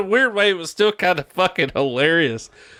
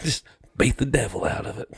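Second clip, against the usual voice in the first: Bob Ross impression; 5 dB quieter than usual. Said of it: "He's like".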